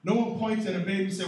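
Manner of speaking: normal talking voice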